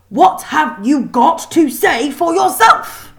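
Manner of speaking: teacher losing patience, shouting slowly; angry repeat. A narrator's reading. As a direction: spell it out in fury. Kraken repeats angrily.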